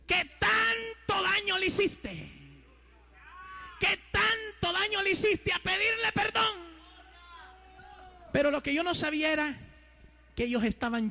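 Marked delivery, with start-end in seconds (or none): 0.1-6.6 high, slow, hammering proclamation; full-force preaching. Acted. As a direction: thunder the charge out slowly on a high pitch. Preaches God's command at full force.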